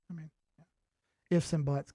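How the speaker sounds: ordinary delivery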